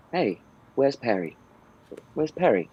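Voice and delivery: lightly voiced cartoon character; a hint of a character voice, short and simple; Ferb voice. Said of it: "in the Ferb voice".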